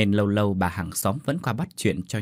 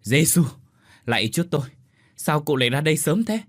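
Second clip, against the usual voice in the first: alarmed exclamation, higher pitched; taken aback, voice raised; startled; 5 semitones higher, 4 dB louder, faster than usual. A narrator's reading. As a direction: react with a start at finding her outside. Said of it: "thì thoảng thốt bảo".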